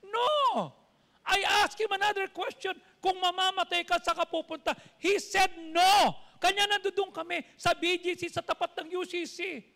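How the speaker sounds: loud, high, insistent storytelling from the pulpit; forceful preaching. Real, not acted. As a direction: belt out the testimony to the congregation.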